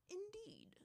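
high soft whisper. Acted. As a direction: breathe the word out. Spirit answers, whispered.

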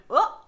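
short, loud, high-pitched yelp; re-enacted yelp. Guest recalling her reaction to a film nomination, loud yelp. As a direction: let out a sudden high yelp. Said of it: "nomination that I actually yelped".

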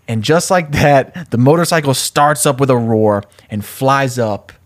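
normal talking voice

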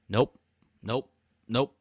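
ordinary talk

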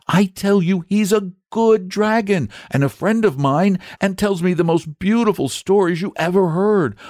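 put-on high, earnest young boy. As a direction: plead for a friend eagerly. Boy defending his friend.

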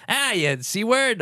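high, drawn-out Aussie impression; mock Australian accent. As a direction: play the token Australian friend. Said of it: "token Australian friend like that".

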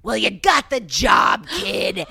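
slow, loud big announcement; mock manager delivering good news; imitating the manager. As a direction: shout it slowly like a big-shot agent. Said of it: "he talked like this".

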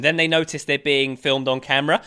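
normal talking voice

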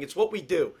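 usual speaking voice

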